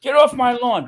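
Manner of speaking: high, loud mock old-man complaint; hammed-up old-timer outburst; grumbling old guy. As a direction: play the cranky old guy yelling at trespassers. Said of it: "I'm just an old guy".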